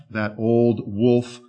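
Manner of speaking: ordinary delivery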